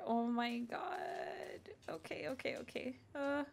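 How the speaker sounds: terrified whisper, barely there; scared whisper. Real, not acted. Streamer hiding from a monster, terrified whisper. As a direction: hush yourself, hiding from danger.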